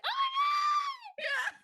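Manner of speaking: very high, slow, loud squeal; screaming with joy inside her head; inner scream of joy. Retold or imitated. Inner reaction to booking the role, ecstatic high squeal. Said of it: "On the inside, I was just like".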